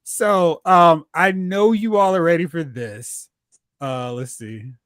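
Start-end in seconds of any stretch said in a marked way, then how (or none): none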